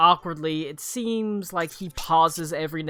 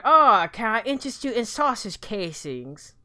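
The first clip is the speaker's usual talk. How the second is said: mock cockney seller; 5 semitones higher, 4 dB louder than usual. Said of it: "I don't want him to be like".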